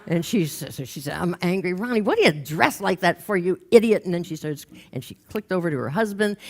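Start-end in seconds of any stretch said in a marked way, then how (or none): 1.1-4.0 building mock outburst, louder at the end; mad. Woman lashing out at Ronnie in group, mad. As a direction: let the anger break out and build to the insult. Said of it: "she got mad at him".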